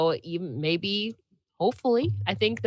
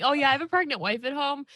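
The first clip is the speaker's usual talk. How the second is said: mock casual; 8 semitones higher, faster than usual. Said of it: "casually dropping".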